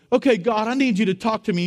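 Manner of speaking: high, pleading make-believe prayer; mock eager prayer. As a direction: play someone eagerly demanding an answer from God. Acts out a naive prayer.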